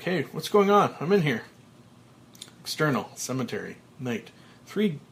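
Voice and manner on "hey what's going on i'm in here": calling out. Acted. Trapped Frank calls out.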